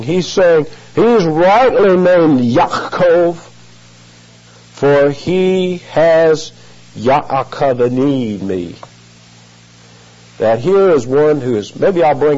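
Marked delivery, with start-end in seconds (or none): 0.9-8.8 high, slow moaning lament. Retold or imitated. Preacher imitating Esau lamenting Jacob's deceit, slow high moan. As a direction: draw the words out like a bitter moan. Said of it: "He is moaning".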